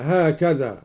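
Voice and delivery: ordinary talk, nothing marked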